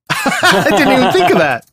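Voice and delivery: laughing through the words. Real, not acted. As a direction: giggle it out. Laughs while answering.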